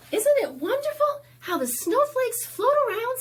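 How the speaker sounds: quick, high and loud wonder; high girl's voice. Acted. Abby marveling at the snowflakes to Pedro, high and quick. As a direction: gush about it like a delighted little girl.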